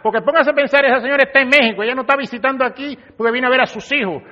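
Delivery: normal talking voice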